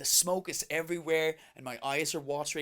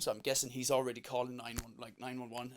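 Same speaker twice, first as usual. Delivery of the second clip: ordinary talk, nothing marked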